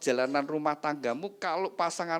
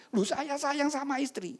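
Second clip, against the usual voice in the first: high-pitched indignant protest; acted husband's high objection; 13 semitones higher than usual. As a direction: protest in a raised pitch, as if wrongly accused.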